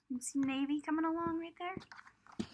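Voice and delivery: quietly asking the little listener; hushed aside. Real, not acted. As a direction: point at the picture in a whisper. Pointing out picture to child.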